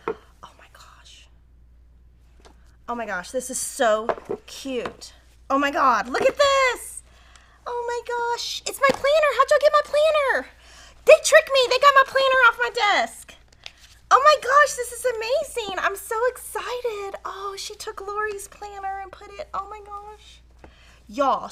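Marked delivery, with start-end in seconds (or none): 0.1-1.3 hushed, slow gasp of wonder; awed whisper. Real, not acted. Vlogger opening a birthday gift, awed whisper. 5.5-20.4 much higher and louder, gushing with delight; real excited squeal. Real, not acted. Vlogger discovering her planner made into a gift, high excited squeal.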